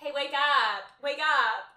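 mock shouting to rouse someone; wake-up shout. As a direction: imitate yelling at a sleeper. Acts Posh waking a coma patient.

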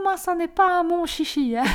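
loud, high Flemish midwife imitation; Flemish accent. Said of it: "elle avait un fort accent flamand". (accent flamand caricaturé, aigu et fort)

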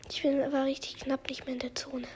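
soft relieved whisper; real hushed relief. Real, not acted.

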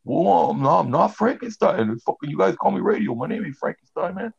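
mock him protesting he isn't Frankenstein; whiny big guy. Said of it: "friends mock the big guy".

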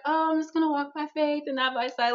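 lightly put-on pious voice; mock piety, slightly lifted; mock over-spiritual. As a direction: imitate someone over-spiritualizing. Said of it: "over-spiritualize it".

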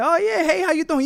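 high, light, eager phone greeting; super excited. Acted. Job seeker taking the one o'clock phone call, excited. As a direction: answer high and soft with eager cheer. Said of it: "You sound super excited".

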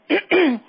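rough throat clear. Real, not acted.

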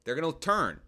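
normal talking voice

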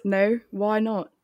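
put-on British accent. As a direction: play at sounding British. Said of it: "rest of the podcast in British".

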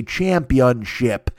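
Championship said slowly and deliberately; measured, reverent Championship; full word, respectfully. Shown as a demonstration. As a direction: give "Championship" every syllable, slowly. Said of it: "the respect it deserves and you say".

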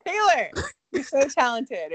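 loud, high gush; loud, high-pitched gushing at Taylor's accent; excited praise. Real, not acted. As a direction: burst out admiring a friend. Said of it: "teasing praise, loud and high".

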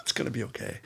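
soft, low soothing it's gonna be okay; mock comfort. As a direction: pat her on the head with it. Mock consoling the friend.

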